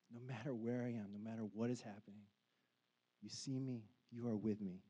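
quiet, tender assurance; soft reassurance. Acted. Preacher comforting listeners, soft and tender.

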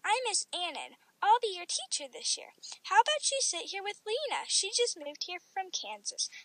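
lifted, cheery storybook greeting; joyful teacher. A narrator's reading. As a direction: greet a new pupil joyfully. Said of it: "my teacher said joyfully".

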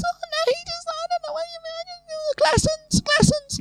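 very high, squeaky falsetto babble; mocking whiny woman. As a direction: mimic her denial in a ridiculous squeal. Mimics friend denying it all.

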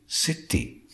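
breathy whispered question to oneself; a hushed, puzzled aside. Acted. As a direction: wonder aloud under the breath. Narrator puzzles over letter.

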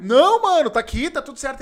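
high, loud no man; loud protest. Retold or imitated. Him refusing Roger's trip plan, loud exasperated protest.

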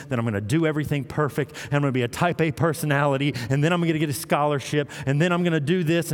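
a mock type-A kid hurrying through his to-do list; rushed eager listing. Preacher voicing the older son trying to earn love, fast and breathless. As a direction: rattle off the plans fast, like an overachiever.

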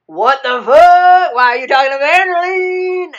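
high, very loud mock outrage; outraged yelling. Ray confronts Naveen about Evangeline, high outraged yell. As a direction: yell it up high, drawing out the first words. Ray's jealous outburst, acted in retelling.

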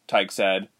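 plain reading voice

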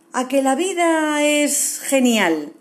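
slow bright moral. A narrator's reading. Moral of the story.

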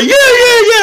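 high, loud imitation of comics cheering; mock cheering comics. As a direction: whoop it up like egging someone on.

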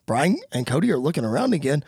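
ordinary delivery

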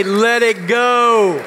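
loud, very slow chant to the neighbor; slow rallying call. Shown as a demonstration. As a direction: draw out each word. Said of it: "turn to your neighbor and say".

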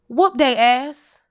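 slow and loud, each word landed; deliberate punchline. Acted. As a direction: deliver it slow and heavy like a verdict. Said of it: "I'ma say this as calmly and, and effectively as I possibly can".

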